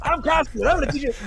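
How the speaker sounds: mock infomercial announcer, booming and eager; Billy Mays pitch. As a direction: oversell it like a late-night infomercial host. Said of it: "it came off as a Billy Mays infomercial".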